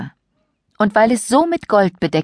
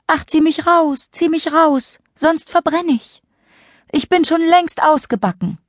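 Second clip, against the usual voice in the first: high, pleading little voice; pleading bread voice; 11 semitones higher than usual. Acted. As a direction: beg to be pulled out, high. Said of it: "Das Brot aber rief".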